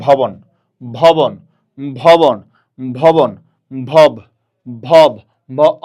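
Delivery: "ভবন" loudly repeated, then cut down to "ভব"; loud teacher's model of "ভবন" broken to its first part "ভব"; pronunciation drill. Shown as a demonstration. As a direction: punch out "ভবন" and "ভব", pausing after each. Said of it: "আপনি উচ্চারণ করেন".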